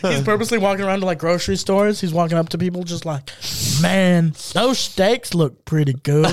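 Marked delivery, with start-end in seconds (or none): 3.8-6.2 slow, coaxing put-on voice; mock-casual nudge toward mischief; sly tempter. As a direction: talk a stranger into it, drawing words out. Contractor slyly tempting shoppers to steal.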